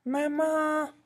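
put-on very high little-girl wail; child's cry. Little girl threatened by the wolf, high-pitched cry. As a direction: cry out high, stretching the last syllable. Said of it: "au cri de la pauvre enfant".

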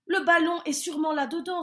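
eager guess; hopeful. A narrator's reading.

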